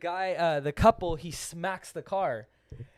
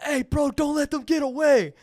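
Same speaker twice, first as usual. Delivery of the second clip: higher, louder egging-on shout; calling out the window; 8 semitones higher, 7 dB louder than usual. Retold or imitated. Egging on the drunk guy from the car window, loud shout. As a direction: yell it out of the car window to hype him. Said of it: "I roll down the window and I was like".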